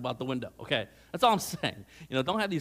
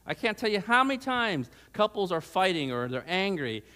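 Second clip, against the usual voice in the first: normal talking voice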